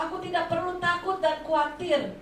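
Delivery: loud, high preaching proclamation; preacher leading the congregation in confession; faith declaration. Shown as a demonstration. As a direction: declare it boldly at full force. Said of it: "Saudara ucapkan".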